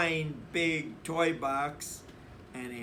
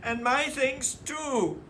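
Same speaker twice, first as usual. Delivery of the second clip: put-on much higher child's protest; crying out; 10 semitones higher, 4 dB louder than usual. A narrator's reading. Brother joining the complaint to Papa, high protest. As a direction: chime in indignantly. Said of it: "cried brother".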